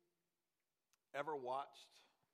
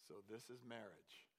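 much softer, lower resigned; deflated quiet; 5 semitones lower, 14 dB quieter than usual. Retold or imitated. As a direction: let it fall flat and quiet. Son voiced, deflated.

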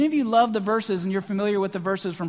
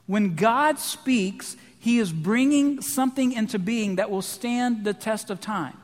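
normal talking voice

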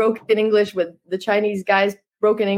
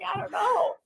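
a tiny, soft, high-pitched "I don't know"; a bewildered cook shrugging at a pizza demand; meek, high, much softer mock reply; confused cook; 13 semitones higher, 8 dB quieter than usual. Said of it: "And the guy's just like".

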